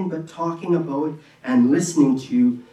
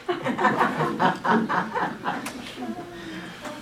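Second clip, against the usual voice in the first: real laugh at himself; 12 semitones higher, 6 dB quieter than usual. Real, not acted. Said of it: "That didn't sound".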